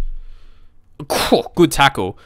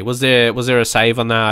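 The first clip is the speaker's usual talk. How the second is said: normal talking voice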